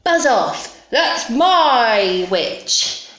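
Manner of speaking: slow, emphatic monster warning; terrible beast voice. Acted. Beast warns the dragon off, drawn-out threatening voice. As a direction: stretch MY out, loud and threatening. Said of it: "its terrible voice".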